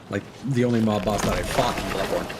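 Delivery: ordinary delivery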